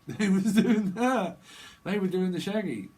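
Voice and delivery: laughing while acting out a silly denial. Real, not acted. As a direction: crack up mid-excuse. Denial quote breaks into laughter.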